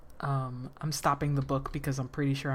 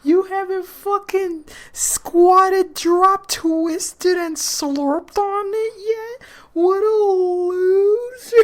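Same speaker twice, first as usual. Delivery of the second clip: high, loud, slow taunting; mocking; 14 semitones higher, 9 dB louder, much slower than usual. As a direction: jeer like a mean girl.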